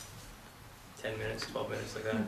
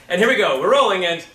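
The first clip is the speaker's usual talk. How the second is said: loud, higher set call; calling the take; 6 semitones higher, 11 dB louder than usual. Acted. Coach playing the film crew before a take, calling out loudly. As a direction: announce the roll briskly to everyone.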